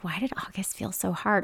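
hushed, breathy self-questioning whisper. Retold or imitated. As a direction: whisper it to yourself, drained. Self-quote of inner thought.

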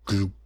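wet, gulping gloop; a thick bubbling gloop; slimy swallowing gloop; gloop sound. A narrator's reading. Creature's wet gloop noise.

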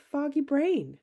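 usual speaking voice

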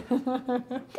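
short quiet laugh; soft laugh. Real, not acted. Laughing at the co-host's joke, softly. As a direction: let a small laugh slip out.

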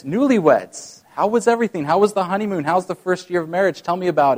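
cheerful Peter checking in on newlyweds; eager greeting. Retold or imitated. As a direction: greet them like a jolly host.

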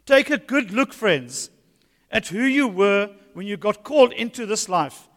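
emphatic scripture reading; proclaiming the text to the church. Acted. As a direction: read it out with weight. Reads the Message paraphrase.

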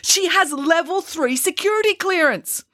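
high, slow, emphatic protest; agitated insistence, drawn out; agitated emphasis. A narrator's reading. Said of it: "trying to emphasize his point".

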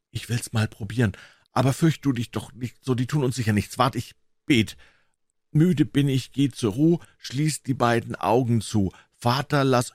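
put-on high boy's voice, trying to be brave; reassure, then pray hurriedly in a young voice; anxious boy Tom. Narrator voices Tom praying anxiously.

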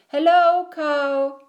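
high, loud cheery hello; Duck calling out. A narrator's reading. Duck greets Cow on the ride, calling out high.

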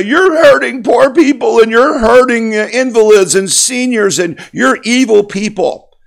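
high, loud whiny mock of outraged critics; mocking opponents. Mocking the government's critics of the strike in a high, loud whine. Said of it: "And they'll say".

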